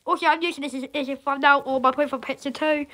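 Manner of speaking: usual speaking voice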